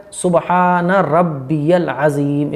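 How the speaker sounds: slow, measured "सुबहान रब्बियल अज़ीम"; correct recitation. Shown as a demonstration. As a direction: draw out each long vowel of "सुबहान रब्बियल अज़ीम". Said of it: "इस तरीके से।". ("सुबहान रब्बियल अज़ीम" ठहरकर, सही)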